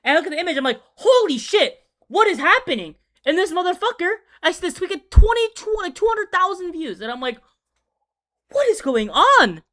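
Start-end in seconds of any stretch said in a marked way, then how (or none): 1.0-3.0 loud, high shocked outburst; shocked exclamation. Retold or imitated. Reliving the shock of seeing the leaked image, loud and high. 8.5-9.6 stunned. Retold or imitated. Retold shock at views.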